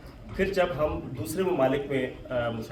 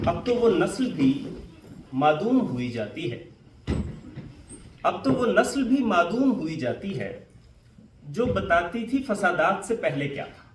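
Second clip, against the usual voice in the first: raised, emphatic mushaira delivery; Urdu couplet recitation; 4 semitones higher than usual. Acted. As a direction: recite the verse in measured phrases, pausing between them.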